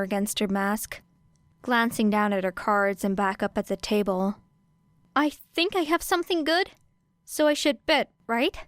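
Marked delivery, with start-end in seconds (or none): 5.1-8.6 higher, eager and unsure; bright put-on innocence with a questioning lift; faux-naive girl. A narrator's reading. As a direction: play the clueless beginner. Era playing the naive newbie.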